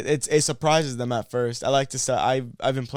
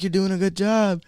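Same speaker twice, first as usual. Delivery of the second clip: high encouraging voice; 8 semitones higher, 3 dB louder than usual. Retold or imitated. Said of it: "she's like".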